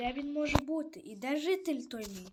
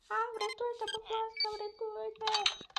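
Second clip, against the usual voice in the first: high, thin voice begging for rescue; villager crying for help; 8 semitones higher, 3 dB quieter than usual. Acted. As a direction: squeak out a panicked plea to be saved, slowing on the last word. (тонкий високий голос жителя, що благає врятувати)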